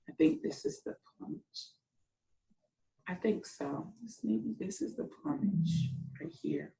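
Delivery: softly thinking aloud, near whisper; quiet aside. Real, not acted. Teacher studies the picture, hushed aside. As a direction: murmur to yourself while looking. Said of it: "teacher musing over picture quietly".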